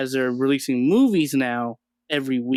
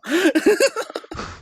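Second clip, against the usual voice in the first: high laugh. Real, not acted. Laughing at the retold scene.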